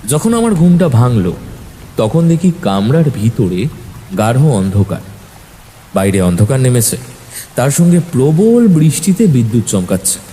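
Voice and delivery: ordinary delivery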